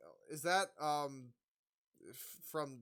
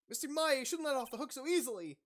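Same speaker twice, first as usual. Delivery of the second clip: higher and louder scolding; voiced character; 11 semitones higher, 7 dB louder than usual. Character scolding Maya, higher chiding voice. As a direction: pitch up and chide.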